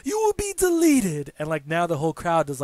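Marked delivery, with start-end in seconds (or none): 0.1-1.3 put-on much higher and louder menace in Broken Matt's accent. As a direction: proclaim doom like a deranged showman in Broken Matt's accent. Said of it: "he's like".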